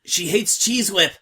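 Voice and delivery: blurted out high and loud. A narrator's reading. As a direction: it bursts out of her. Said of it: "she blurted".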